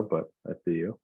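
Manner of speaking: ordinary talk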